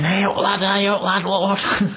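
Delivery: put-on an old man hollering for help with his catch; retold excited shout. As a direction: yell it like an old angler hooking a big carp. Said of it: "And he's shouting".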